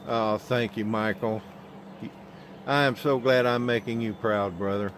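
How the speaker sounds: usual speaking voice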